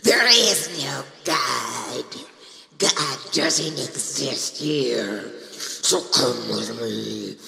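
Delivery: slow, breathy demonic whisper. Shown as a demonstration. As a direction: lure them in slowly. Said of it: "doing demon voices".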